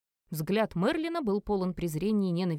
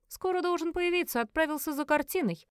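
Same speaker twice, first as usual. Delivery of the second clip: put-on high, lighter young villain's voice; Verlian's voice; 7 semitones higher than usual. Son reports to his father Merlin, high-voiced and offhand. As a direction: answer in a raised, offhand voice.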